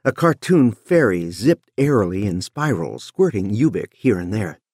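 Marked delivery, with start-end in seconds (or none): none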